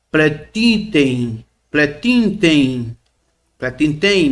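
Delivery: pretiten, said slowly as plé-ti-ten with a falling end; slow Greek demo. Shown as a demonstration. Teacher drilling students on a Greek word, slowly. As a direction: spell out pretiten syllable by syllable, slowly. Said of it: "melhor dizendo, pretiten".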